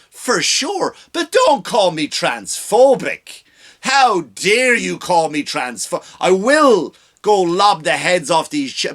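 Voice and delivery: hammed-up offended Darth Vader; mock whiny Vader. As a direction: play a villain offended at being called names.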